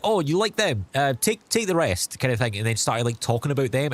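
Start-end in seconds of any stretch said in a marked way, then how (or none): 0.0-2.2 father-in-law impression. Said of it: "He was like".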